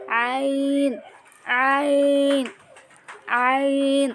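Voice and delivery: আইন pressed from the middle of the throat; Arabic letter demo. Shown as a demonstration. Teacher showing students how to say আইন. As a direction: squeeze আইন out of mid-throat, drawn out. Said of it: "মাঝামাঝি অংশে একটু চাপ দিতে হবে".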